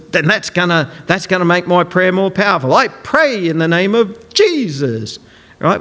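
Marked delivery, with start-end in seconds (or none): 2.7-5.2 "Jesus" drawn out and punched; slow down and lean hard on "Jesus"; stress on Jesus. Shown as a demonstration. Preacher parodies emphatic prayer to congregation, overstressing "Jesus". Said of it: "emphasise certain words".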